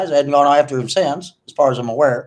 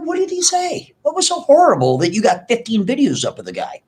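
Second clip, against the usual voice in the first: incredulous; 7 semitones higher than usual. Retold or imitated. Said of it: "I'm like".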